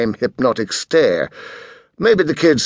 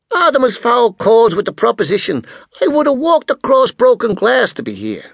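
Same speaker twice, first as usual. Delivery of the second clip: brash American tycoon; 12 semitones higher than usual. A narrator's reading. Spyro voiced again.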